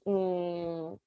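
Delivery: normal talking voice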